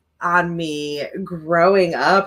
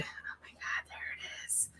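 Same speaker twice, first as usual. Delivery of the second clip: whisper; 26 dB quieter than usual. Real, not acted. Catching his own stutter mid-sentence.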